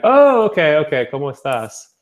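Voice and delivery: light up with relief; sudden relief. Retold or imitated. Learner finally recognizes the greeting, relieved and eager.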